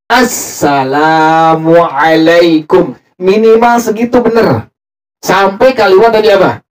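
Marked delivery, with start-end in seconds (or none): 0.0-2.9 slow, "Assalamualaikum" with the lam drawn out; teacher modelling the long lam. Shown as a demonstration. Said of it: "Lamnya panjang".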